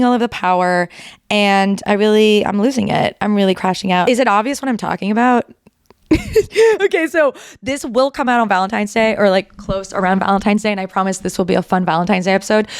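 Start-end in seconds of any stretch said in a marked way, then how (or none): 6.1-6.8 short high burst of laughter; real laugh. Real, not acted. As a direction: laugh it off sheepishly. Laughs after crashing-out remark.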